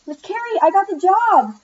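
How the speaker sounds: high-pitched, loud burst of good news; thrilled, bursting to share the news; excited. A narrator's reading. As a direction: announce it excitedly, pitched well up. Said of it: "was excited to tell Miss Carey the good".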